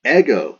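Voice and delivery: "Ego" punched high and loud; emphatic Ego. Shown as a demonstration. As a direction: hit "Ego" hard, with a falling tune. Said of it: "the emphatic I".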